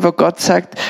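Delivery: ordinary talk, nothing marked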